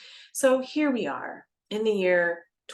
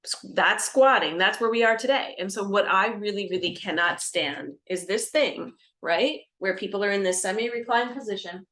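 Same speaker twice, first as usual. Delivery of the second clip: normal talking voice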